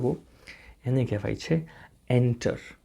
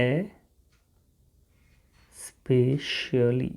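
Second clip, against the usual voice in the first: especially very slowly as "e... spe-sha-lee"; long gap after "e", then stretch each syllable of specially; much slower than usual. Shown as a demonstration. Teacher shows learners how to pronounce it, slow syllable by syllable.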